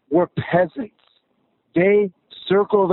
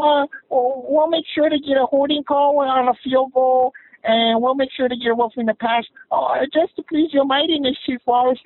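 much higher, cringing mock apology; groveling refs; 8 semitones higher than usual. Caller mimicking refs apologizing to the Chiefs coach, in a much higher cringing voice. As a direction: play the refs bowing and scraping to the coach. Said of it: "and they're like".

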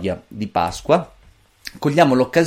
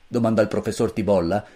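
ordinary delivery, nothing marked

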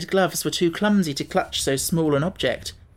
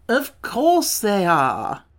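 slow, higher, emphatic assertion; declaring; 6 semitones higher, 3 dB louder, much slower than usual. A narrator's reading. Wogglebug explains the three pills, declaring slowly. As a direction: declare it as obvious, drawn out. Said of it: "the Wogglebug declared".

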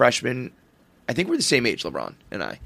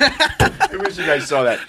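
slow, higher-pitched real laughter in the words; laughing; 4 semitones higher, slower than usual. Real, not acted. Host to co-hosts after a sight gag, laughing. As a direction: say it while still cracking up. Cracking up at something just seen.

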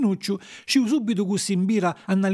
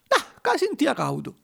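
high, slow offhand excuse; drawn-out high-pitched excuse; making light; 8 semitones higher than usual. Acted. Geppetto makes excuse for sold jacket.

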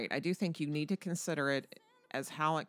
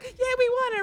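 much higher and louder, cheering in a put-on voice; mock victory squeal; 10 dB louder than usual. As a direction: squeal it like a gleeful winner. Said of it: "I know they're like".

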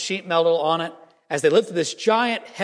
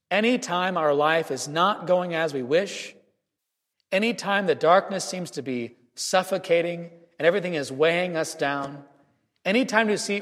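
normal talking voice

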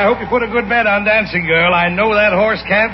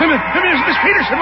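ordinary talk, nothing marked

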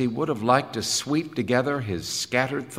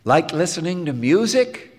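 higher, louder chirpy question; brightly coaxing; 7 semitones higher, 5 dB louder than usual. Acted. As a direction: ask it with upbeat eagerness. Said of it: "said Helmholtz to Jim brightly".

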